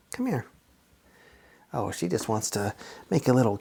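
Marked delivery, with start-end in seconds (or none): 0.1-0.5 soft, high call to a cat; sweet high-pitched beckoning; coaxing a pet. Real, not acted. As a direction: coax the cat over gently, voice lifted. Calling the pet cat over.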